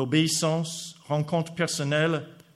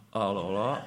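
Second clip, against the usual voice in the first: hésitation embarrassée; 5 semitones lower than usual. Retold or imitated. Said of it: "J'ai dit".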